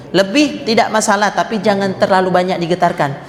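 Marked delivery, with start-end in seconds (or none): none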